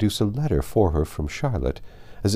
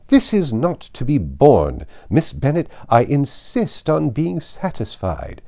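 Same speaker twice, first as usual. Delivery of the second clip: slow, louder, higher imperious fury; indignant insistence; 6 semitones higher, 5 dB louder, slower than usual. Acted. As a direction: spell out each word of the demand. Lady Catherine insists furiously.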